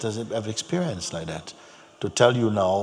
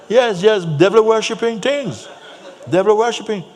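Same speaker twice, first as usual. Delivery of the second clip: high, loud mock insistence; crusty Jamaican man; 13 semitones higher, 9 dB louder than usual. Jamaican man insists it is devil worship, loud crusty accusation. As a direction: hammer the charge home, very loud. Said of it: "very crusty voice".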